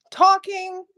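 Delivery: usual speaking voice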